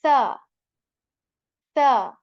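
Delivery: "sa" loud, tongue tip on the teeth, no whistle. Shown as a demonstration. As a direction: hit "sa" crisply without whistle. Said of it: "make a sound like".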